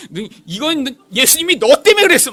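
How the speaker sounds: high, very loud blaming outburst; accusing shout. Retold or imitated. Evangelist in thorn crown blames the children, loud accusing shout. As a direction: hammer the blame at frightened children.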